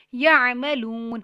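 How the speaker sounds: slow tajweed reading; slow recitation. Shown as a demonstration. Teacher reciting the word slowly. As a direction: read it slowly, no jolt.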